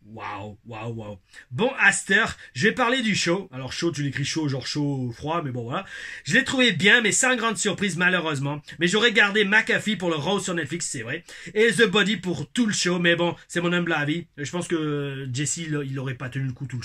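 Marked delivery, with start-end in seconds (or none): 1.5-3.5 put-on Québécois accent. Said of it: "mon accent québécois". 6.2-14.3 put-on Québécois accent. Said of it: "mon accent québécois".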